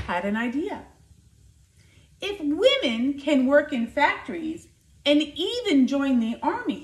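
lively, expressive picture-book reading; reading to children. Acted. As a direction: tell it like a storyteller for a class. Teacher reads picture book aloud.

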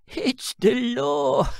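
drawn-out hammed-up official tone; mock solemn pronouncement. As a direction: lay down the law in jest. Second man mock solemn.

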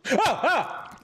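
short shrill yelps of alarm; two high startled cries; high panicked scream. Real, not acted. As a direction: scream as it jumps you. Spider attack.

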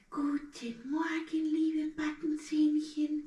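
high, slow greeting to the molars; talking to teeth. Pretend. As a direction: coo to the little molars. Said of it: "Seid ihr wach".

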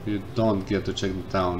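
ordinary talk, nothing marked

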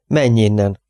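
plain reading voice

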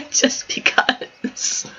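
breathy whispered aside; whispered just because. Real, not acted. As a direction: drop to a secret whisper.

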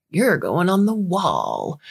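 slow drawling cowboy; lightly voiced John Wayne, slow. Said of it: "in a John Wayne voice".

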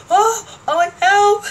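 high, loud fake cry for help; hammed-up helpless yelp; mock panicked guard. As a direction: squeal it like a startled guard. Security guard crying for help.

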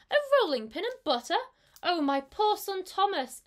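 high, loud anguished cry; mother cat fears for her son, high loud outcry; alarmed exclaim. A narrator's reading. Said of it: "exclaimed Tabitha".

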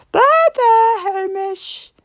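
put-on high, soothing old lady voice; wife comforting the cat in the basket; consoling. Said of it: "said Missus Grinling consolingly".

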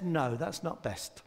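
quiet reassuring voice of God; gentle refusal. Pretend. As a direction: let them down kindly. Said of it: "So sometimes he says".

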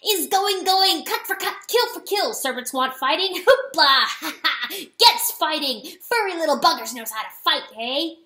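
loud, high weasel cackling over the fight; laughing. A narrator's reading. Bloodied weasel exulting on the wall, laughing. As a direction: crow with wild, battle-drunk laughter. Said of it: "and he was laughing".

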